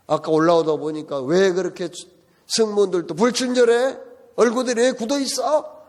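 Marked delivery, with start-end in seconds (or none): 1.2-5.8 mock whiny griping, rising; imitating irritated complaining. Wife grumbling about the dirty cramped train, mock irritated whine. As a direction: gripe at everything like a fussy passenger. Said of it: "불평을 하더래요".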